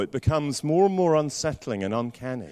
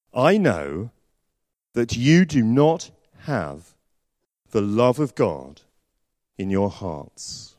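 1 slow solemn words; 3 semitones lower, slower than usual. Acted. Jesus's devastating words.

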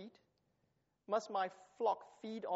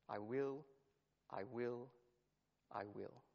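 "I will" softer and lower each time; fading repetition; 7 semitones lower, 8 dB quieter than usual. Shown as a demonstration. Preacher echoing God's promise, fading to near silence. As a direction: let "I will" die away. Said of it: "I will," is repeated again and again".

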